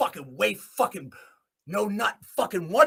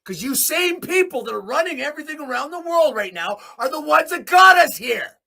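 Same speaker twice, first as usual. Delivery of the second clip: angry shout; 8 semitones higher, 5 dB louder than usual. Real, not acted. Accusing leaders who run the world, angry shout. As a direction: hurl the blame at them.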